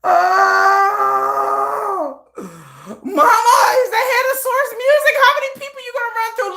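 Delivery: high, loud scolding; exasperated son. Retold or imitated. Son scolding his mother, high and loud. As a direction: scold mom in disbelief.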